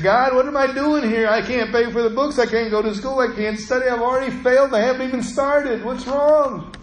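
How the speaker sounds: a touch of tearful despair, imitated; retold crying prayer. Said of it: "I started crying".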